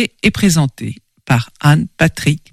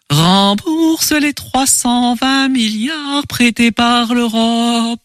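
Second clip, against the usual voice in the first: slow, high, luring chant; siren song voice; 4 semitones higher, much slower than usual. Acted. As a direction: draw out every word seductively. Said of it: "des voix suaves".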